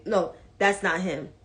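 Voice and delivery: ordinary talk, nothing marked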